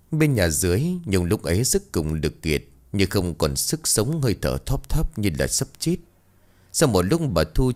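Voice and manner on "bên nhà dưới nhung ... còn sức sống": ordinary delivery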